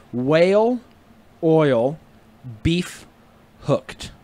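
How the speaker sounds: slowly, with a gap after each word; four separate words, evenly spaced. Shown as a demonstration. Friend teaching a trick phrase, word by word. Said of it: "Like, normal speed".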